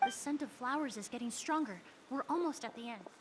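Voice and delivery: like an echo fading in a dream; a far-off repeat of the same words; faint echo. Acted.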